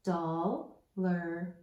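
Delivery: "Dollar" in two parts, doll, ler; corrected chunks. Shown as a demonstration. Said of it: "switch the sound a little bit".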